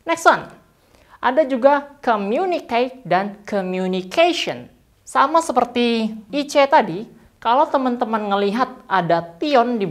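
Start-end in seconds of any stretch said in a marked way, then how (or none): none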